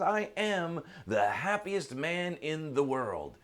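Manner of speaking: slow, high, proud declaration; overjoyed. Acted. Happiest man declares himself to the seeker, overjoyed. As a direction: stretch it out with elated emphasis. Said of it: "he's just so happy and laughing".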